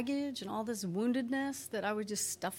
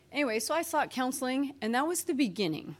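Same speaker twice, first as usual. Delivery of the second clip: usual speaking voice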